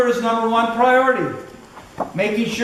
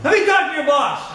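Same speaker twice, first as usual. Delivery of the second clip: indignant Ruff; 8 semitones higher than usual. Retold or imitated. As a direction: mimic the friend shouting back in indignation. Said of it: "He says".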